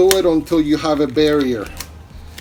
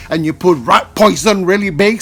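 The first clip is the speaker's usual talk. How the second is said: louder, stressing the label; emphatic; 4 semitones higher, 5 dB louder than usual. Acted. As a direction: make the label point loud. Emphatic instruction about labelling.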